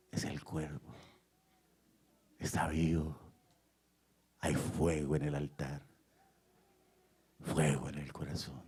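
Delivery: low, soft, long pauses; hushed preaching. Real, not acted. Preacher tells congregation of God's presence, in low hushed reverence. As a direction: let each phrase sink in quietly.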